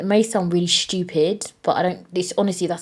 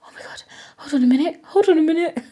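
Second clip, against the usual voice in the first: high, fast, panicky "hold on a minute"; mock panic while still laughing at it; retold panic; 10 semitones higher, 4 dB louder, faster than usual. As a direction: replay the scare, rushed and high-pitched. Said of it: "I was like".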